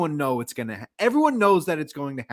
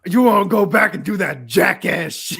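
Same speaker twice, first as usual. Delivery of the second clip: louder mock Roman scolding; acting out Roman; 4 semitones higher, 8 dB louder than usual. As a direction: ham up the taunt. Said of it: "I saved you".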